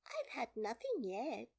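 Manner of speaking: high, affronted little voice; offended. A narrator's reading. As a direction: answer as if slighted. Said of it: "Alice replied in an offended tone".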